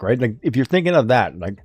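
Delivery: usual speaking voice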